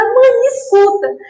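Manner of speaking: high, slow tearful call to her mother; crying plea. Retold or imitated. Daughter crying out to her dead mother, tearful and high. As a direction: let the tears break into the words. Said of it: "E comecei a chorar emocionada".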